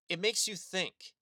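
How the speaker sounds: ordinary delivery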